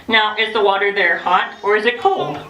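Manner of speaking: ordinary delivery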